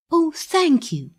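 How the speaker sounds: high, eager thanks; cried out. A narrator's reading. Pinocchio thanks the parrot for the ride, cried out high. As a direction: burst out with grateful joy. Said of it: "cried Pinocchio".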